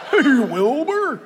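drawn-out talking-horse voice, laughing; Mister Ed. Acted. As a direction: stretch it slowly like a TV horse. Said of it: "you know, like Mister Ed".

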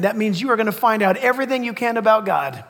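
usual speaking voice

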